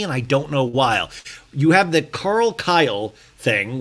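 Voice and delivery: ordinary talk, nothing marked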